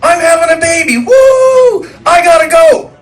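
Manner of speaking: high, loud, whooping announcement; excited shout. Retold or imitated. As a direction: whoop the news to the crowd. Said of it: "I was like".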